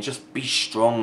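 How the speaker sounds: slow, drawn-out mocking whine; slow sarcastic parody of a sad fan; mock whiny fan. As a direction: drag it out like a whining fan. Mocking a fan's comment.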